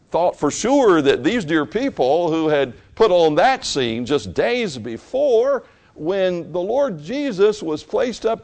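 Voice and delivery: high, loud, rising pulpit preaching; impassioned sermon climax; fervent preaching. Acted. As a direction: preach it at full force to the congregation.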